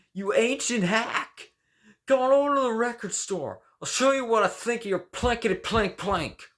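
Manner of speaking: very high, very loud mocking tirade; furious rant. Former disciple raging at Cohen, shrieking furious tirade. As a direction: spit contempt at the old master.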